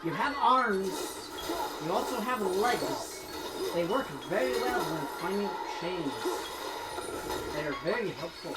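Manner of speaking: emphatic mock lecture in Scottish brogue; put-on Scottish accent. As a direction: lecture Kratos like a Scotsman, stretching key words. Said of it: "accent time is over".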